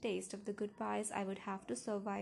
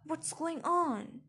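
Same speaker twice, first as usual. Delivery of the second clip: raised, louder worried questioning; frightened; 5 semitones higher, 5 dB louder than usual. A narrator's reading. Father pounding on his daughter's door, frightened. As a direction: call out in alarm. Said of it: "His voice was frightened".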